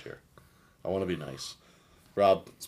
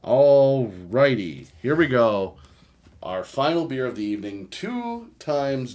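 loud, drawn-out hype intro; excited announcer; 7 semitones higher, 9 dB louder, slower than usual. Real, not acted. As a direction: kick off the finale big. Hyped intro of final beer.

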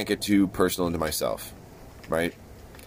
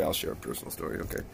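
muttered aside; 7 semitones lower, 11 dB quieter, faster than usual. Real, not acted.

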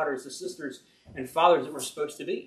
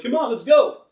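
a hint of an eager friend calling ahead, higher and louder; lightly voiced buddy urging him up the stairs; impatient friend; 8 semitones higher, 7 dB louder than usual. Imitating a friend calling him up the stairs, higher and louder.

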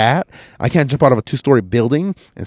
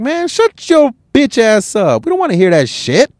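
mocking rude friend; 10 semitones higher than usual. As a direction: sneer it like a lazy friend shutting you down. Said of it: "They're always like".

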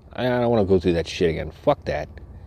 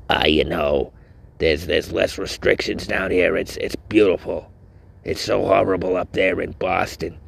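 coworker imitation. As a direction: mimic him, dragging the words out. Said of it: "he talks like this".

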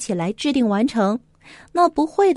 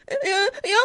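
frightened cry; 9 semitones higher, 3 dB quieter, much faster than usual. Acted. Frightened cub cries out.

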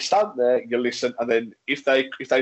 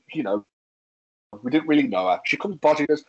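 usual speaking voice

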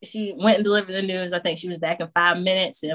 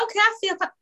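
squeaky okay; 13 semitones higher, faster than usual. Retold or imitated. Quote just before nausea.

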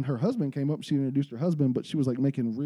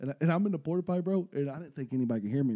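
ordinary talk, nothing marked

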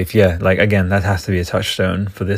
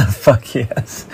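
laughing out a delighted "Fuck yes"; gleeful laughing yes; laughing cheer; 2 semitones higher than usual. Real, not acted. Excited cheer about asteroid fields.

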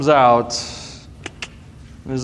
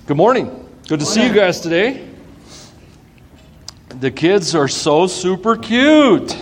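usual speaking voice

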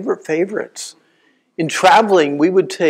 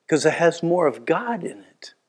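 put-on whispered softly like a frail old woman; soft grandmotherly whisper; 6 dB quieter than usual. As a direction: breathe it as a tender secret. Grandma's quiet answer.

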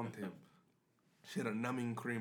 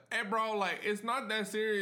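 raised, higher voice; calling over; 8 semitones higher, 4 dB louder than usual. Retold or imitated. Coworker telling angry customer to relax, calling out. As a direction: call it across to calm him down.

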